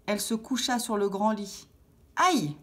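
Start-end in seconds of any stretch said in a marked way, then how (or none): 2.2-2.6 high-pitched storybook "ouch"; pained exclamation. A narrator's reading. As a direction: yelp it like a little girl. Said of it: "s'exclama-t-elle". (exclamation de douleur, aiguë)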